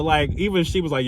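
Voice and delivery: normal talking voice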